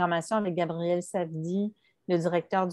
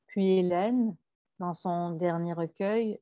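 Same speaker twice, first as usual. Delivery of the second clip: ordinary talk, nothing marked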